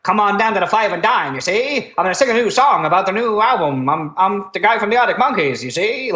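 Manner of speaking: put-on high-pitched, old-time American pitchman patter; hammed-up American accent. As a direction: parody a fast-talking transatlantic American showman. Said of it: "he's not going like".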